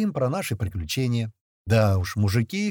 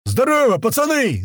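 yelled greeting; 12 semitones higher, 7 dB louder than usual. A narrator's reading. Sliva greets the boys from the ranks, yelled greeting. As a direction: holler it from somewhere back in the ranks. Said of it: "заорал откуда-то из строя Слива".

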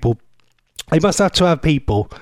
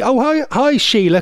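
bird voice; 7 semitones higher than usual. Retold or imitated. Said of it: "little dicky bird ... said to me".

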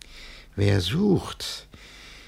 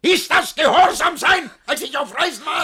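much higher, louder tirade; furious reproach; 9 dB louder than usual. Acted. Master back from travel, raging at the servants. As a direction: roar the question at them.

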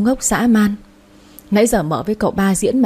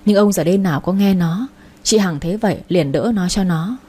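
ordinary delivery

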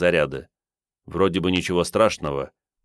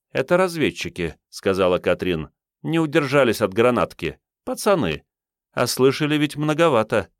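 higher woman's voice; female character voice; 6 semitones higher than usual. A narrator's reading. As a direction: explain it coolly as Katrin. Katrin voiced.

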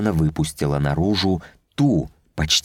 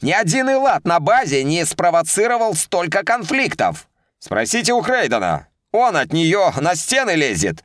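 mocking; 11 semitones higher than usual. A narrator's reading. Officers mock over laughter.

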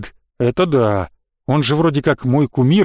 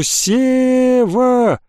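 high, long-drawn name call; distant call; 7 semitones higher than usual. A narrator's reading. Trapped boy calling his friend, drawn-out cry. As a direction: call up from under the ground. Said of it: "донеслось до меня откуда-то снизу".

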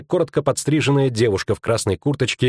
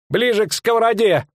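high-pitched ordering cry; innkeeper calling out; 12 semitones higher than usual. Acted. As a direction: order her over in a high voice. Innkeeper calls out to cover her.

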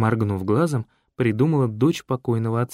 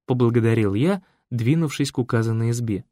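usual speaking voice

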